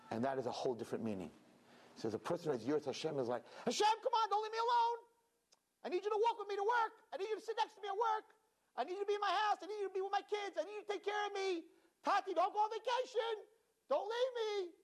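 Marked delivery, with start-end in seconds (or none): 3.6-14.7 put-on pleading child. Child begging Hashem not to leave, high pleading. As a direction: beg like a scared little kid.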